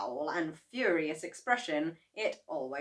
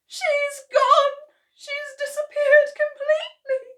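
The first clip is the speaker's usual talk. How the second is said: high, loud, tearful cry of a distraught daughter; 12 dB louder than usual. Acted. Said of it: "cried Missus Cranky".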